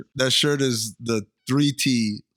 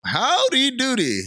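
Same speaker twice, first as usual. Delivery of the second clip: comic exclamation; 11 semitones higher than usual. Pretend. Comic exclamation after quoted line.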